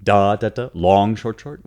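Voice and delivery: da da da as long short short, first da held and stressed; dactyl rhythm. Shown as a demonstration. Teacher demonstrates the dactyl foot, galloping long short short. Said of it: "It can be a dactyl".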